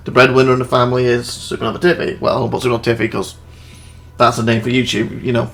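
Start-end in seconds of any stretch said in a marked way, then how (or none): none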